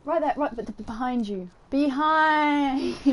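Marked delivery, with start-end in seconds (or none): 1.7-3.1 panto call. Acted.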